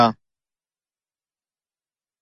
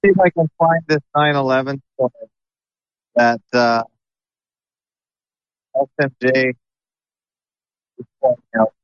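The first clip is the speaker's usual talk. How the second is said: underwater; much faster than usual. Real, not acted. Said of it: "You sound like you're underwater".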